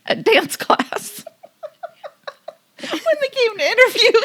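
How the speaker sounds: high, breaking into laughter mid-sentence; laughing through words. Real, not acted. Host cracking up while telling the mime story. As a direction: try to talk but crack up.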